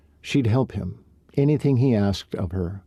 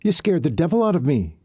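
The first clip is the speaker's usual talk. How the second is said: startled; 8 semitones higher than usual. Acted. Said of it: "plastered a hand to her chest".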